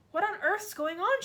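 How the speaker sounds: put-on high, loud alarmed girl's voice; a little girl bursting in, alarmed; child crying out. A narrator's reading. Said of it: "she cried".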